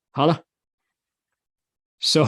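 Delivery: ordinary delivery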